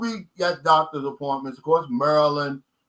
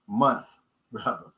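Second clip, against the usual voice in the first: Kentucky accent; 6 semitones lower than usual. Real, not acted. Explaining his own word to viewers, Kentucky accent. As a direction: say "Month" slow, Kentucky-style. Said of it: "That's just my old Kentucky accent".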